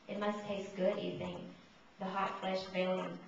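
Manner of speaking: measured, solemn poem reading; poetry reading. Acted. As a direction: read it like a poet at a mic. Reads a second poem.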